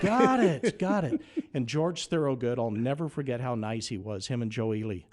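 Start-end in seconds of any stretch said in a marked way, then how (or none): 0.0-1.2 eager bluffing. Retold or imitated. Mimics his eager acceptance.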